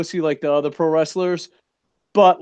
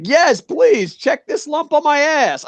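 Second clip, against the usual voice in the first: higher mocking voice of a willing patient; mock eager patient; 10 semitones higher, slower than usual. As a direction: play a hammed-up keen patient offering the lump. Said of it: "would you be like".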